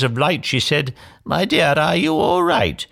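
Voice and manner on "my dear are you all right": lightly voiced fairy, higher pitch; a high, solicitous inquiry; concerned fairy godmother. A narrator's reading. Magic fairy checks on trapped Cinderella, concerned.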